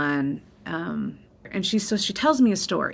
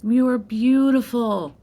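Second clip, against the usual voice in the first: tender reassurance; 6 semitones higher, 4 dB louder than usual. Retold or imitated. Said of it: "She's like".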